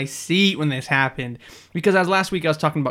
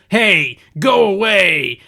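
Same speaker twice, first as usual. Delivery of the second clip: louder, slow mock bark: hey, go away; gruff shove-off; 5 semitones higher, 8 dB louder, much slower than usual. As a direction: bark it slowly like a thug shoving a poster in his face. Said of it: "shoves it in his face and says".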